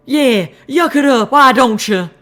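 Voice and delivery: high, loud, slow grumpy squeak; annoyed squeaky voice. Acted. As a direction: glower through a tiny critter voice. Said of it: "Calamity glowered at me".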